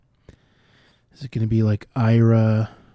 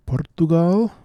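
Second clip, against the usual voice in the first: Portugal in a mock Portuguese accent, drawn out and higher; put-on Portuguese accent; 7 semitones higher than usual. Shown as a demonstration. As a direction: say Portugal slowly with an excited fake Portuguese accent. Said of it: "I shouldn't say it in that accent".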